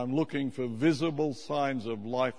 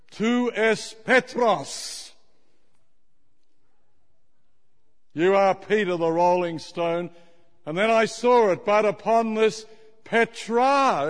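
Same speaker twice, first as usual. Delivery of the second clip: preaching; 6 semitones higher, 6 dB louder than usual. Acted. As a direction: read it out with awe. Reading the dome inscription aloud.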